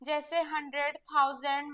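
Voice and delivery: ordinary talk, nothing marked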